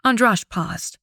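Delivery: ordinary delivery, nothing marked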